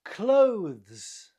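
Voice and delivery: "Clothes" as one long, loud syllable; correct pronunciation. Shown as a demonstration. Teacher models the right way, "Clothes" as one syllable. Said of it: "Not clothes, but clothes".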